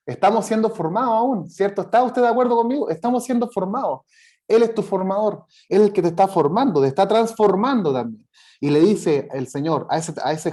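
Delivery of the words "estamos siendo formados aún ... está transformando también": fervent preacher's call to the congregation; rising preaching fervor. Acted. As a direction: lift the congregation with rising conviction. Preacher builds to full force.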